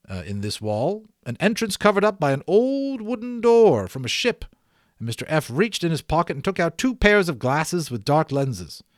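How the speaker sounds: ordinary delivery, nothing marked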